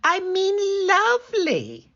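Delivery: wolf squeaking to hide his voice. Acted. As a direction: cover it with a squeak. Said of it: "he added in a squeak".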